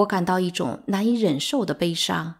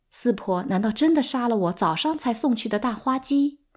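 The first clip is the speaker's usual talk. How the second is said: usual speaking voice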